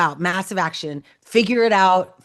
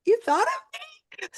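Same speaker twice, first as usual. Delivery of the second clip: high, squeaky imitation of a touched recipient; touched surprise; faster than usual. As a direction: mimic someone surprised to be remembered. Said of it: "always just genuine".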